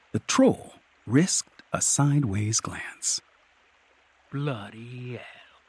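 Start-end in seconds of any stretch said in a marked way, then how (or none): 4.3-5.6 slow, very soft oath; whispered. A narrator's reading. Troll realizing who crept up on it, whispered. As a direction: breathe it out slowly, hushed. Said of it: "it whispered".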